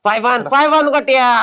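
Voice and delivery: urgent order. Acted. Kidnapper tells accomplice to tie the legs, urgent.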